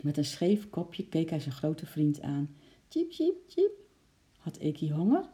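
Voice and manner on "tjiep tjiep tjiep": series of very high chirps; squirrel's squeak. A narrator's reading. Squirrel looking to his big friend, squeaky cheeping. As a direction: cheep pleadingly like a tiny squirrel.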